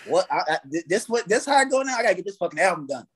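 fast, urgent self-talk; rushed hustle. Retold or imitated. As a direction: rattle it off like you must hurry. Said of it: "I'm like".

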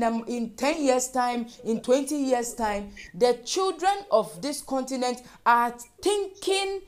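loud, insistent warning to the congregation; impassioned preaching. Real, not acted. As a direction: preach it urgently, driving each point home. Exhorts the congregation mid-prayer.

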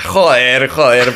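mock Castilian "Joder, joder" from the stepfather; Spain Spanish accent. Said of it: "porque, ya sabes, eh, aprende allá".

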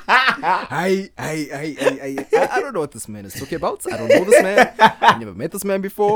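loud laughter through the words; cracking up and brushing off a joke; laughing. Real, not acted. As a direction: laugh while denying it all. Laughing, denying friend's joke.

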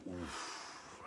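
very soft, voiceless exhaled oof; re-enacted winded reaction. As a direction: let the breath go as if struck by the moral. Own reaction on hearing the moral.

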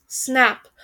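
ordinary delivery, nothing marked

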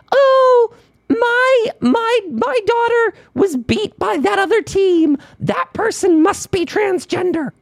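very high, mock-wailing parent; hammed-up shrieky whine of a sore-loser parent; outraged parent. Parent accusing the other team's player, high mock wail. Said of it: "parents have the right to say".

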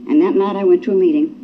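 ordinary talk

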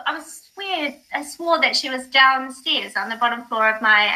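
normal talking voice

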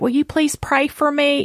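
high-pitched pleading, retold; desperate plea. Asking her friend to pray for her, desperate. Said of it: "I was so desperate".

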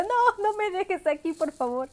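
put-on a child begging her mom to stay; mock pleading. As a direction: plead not to be left alone. Begging mom not to leave her.

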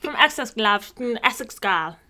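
put-on Essex accent, teasing. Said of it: "do it in a British accent".

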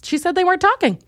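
high-pitched indignant repeat; high insistence. Real, not acted. Fan insists the rappers weren't talking, high insistence.

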